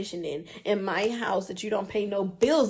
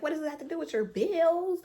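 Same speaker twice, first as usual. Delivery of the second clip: higher pitched mock of his angry question; mocking angry boyfriend; 8 semitones higher than usual. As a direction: imitate him, pitched up and indignant. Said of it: "He was like".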